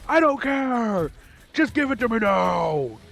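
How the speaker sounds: put-on high, loud, slow childish demand; whine-shout like a spoiled kid; kid tantrum. Retold childhood tantrum for game.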